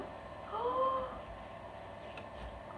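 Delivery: long, soft, high gasp of wonder; delighted gasp. Real, not acted. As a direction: gasp at a lovely surprise. Gasps at gingerbread man.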